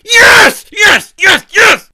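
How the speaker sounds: loud, high triumphant yes shouts; excited yelling. Real, not acted. Host celebrating the lost ruler, shrieking with joy. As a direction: cheer like you just won. Jubilant after winning the bet.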